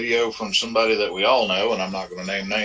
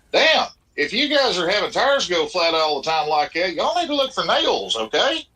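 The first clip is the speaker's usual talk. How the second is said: mock incredulous; 8 semitones higher than usual. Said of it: "And I'm thinking".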